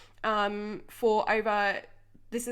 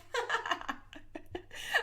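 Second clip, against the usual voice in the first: high, airy, voiceless laughter; real winded giggle, softer and trailing; breathless laugh; 13 semitones higher, 5 dB quieter than usual. Real, not acted. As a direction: keep laughing with no breath left.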